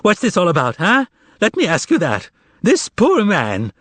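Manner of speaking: milk their pity; quavering plea. Acted. Korovyov pleading for Behemoth with a quaver. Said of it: "added a quaver to his voice".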